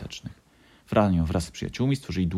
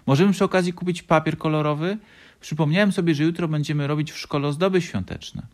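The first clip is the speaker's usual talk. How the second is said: high, loud little-fox voice; eager child character; 9 semitones higher, 5 dB louder than usual. A narrator's reading. Little fox asking for coloured paper, high and eager. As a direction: pipe up eagerly, pitched well up.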